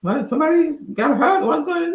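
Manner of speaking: questions climbing sharply in pitch; alarmed questioning. Retold or imitated. Driver asking yelling sister what's wrong, alarmed.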